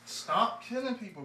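loud, slow scolding. Acted. Friend scolds his buddy for killing people, loud and slow. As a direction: tell him off loudly.

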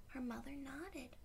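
hushed storyteller whisper. A narrator's reading. Narrator whispering to little listeners. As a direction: breathe the line softly.

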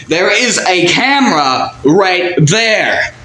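high, slow, drawn-out insistence; worked-up acted exasperation; exasperated insistence. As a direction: insist at the top of your range, stretching each word. Insisting during acted quarrel.

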